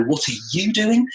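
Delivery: rising curious "What are you doing?"; "What are you doing?" lifted high and rising at the end; intonation shift. Shown as a demonstration. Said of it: "depending on where you put the intonation in".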